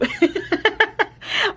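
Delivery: a sudden high laugh bursting out. Real, not acted. Laughing at her old Super Nanny accent habit, high burst.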